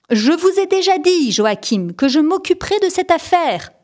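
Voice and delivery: teacher loses patience with Joachim, exasperated shout. A narrator's reading. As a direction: snap at the pupil, at the end of her patience. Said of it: "a crié la maîtresse".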